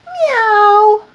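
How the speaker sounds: a little cat calling out; high loud meow. Acted. Each animal says what it wants, high meow. Cats in chorus.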